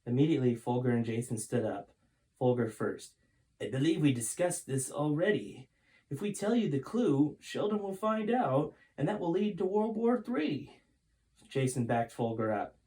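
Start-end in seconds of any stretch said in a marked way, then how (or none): 3.6-10.8 high-pitched, lightly voiced old man, grave warning; Folger's voice. Folger refuses the clue.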